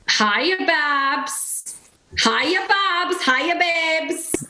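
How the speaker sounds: high, lilting Scottish greeting; Lawrence Chaney's Scottish accent. Shown as a demonstration. Contestant doing Lawrence for the challenge, high Scottish accent. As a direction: sing out the greeting in a put-on Scots accent. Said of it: "Lawrence Chaney is from Scotland".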